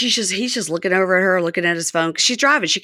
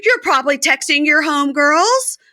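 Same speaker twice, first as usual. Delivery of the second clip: much higher, louder mock rant; voicing Joy ranting; 13 semitones higher, 3 dB louder than usual. Podcaster as Joy ranting at Red, high and loud. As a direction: fire off jealous accusations.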